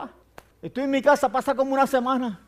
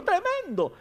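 high-pitched imitation of dad's exclamation; father's tone; 9 semitones higher, 3 dB quieter, slower than usual. Son mimicking his father's reply on the trip, high-pitched tone. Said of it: "ese tono de papi".

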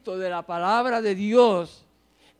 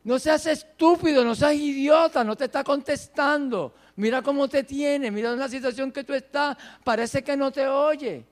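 higher, pressing, scornful tirade; taunting crowd; 5 semitones higher, 5 dB louder than usual. Acted. People mocking the believer in trouble, taunting. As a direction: pile up the taunts in a raised, higher voice. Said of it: "donde la gente le dice".

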